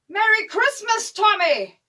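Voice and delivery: high, loud, stretched-out greeting; festive shout. Acted. German wishing the British merry Christmas, shouting. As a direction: holler it over to the other trench.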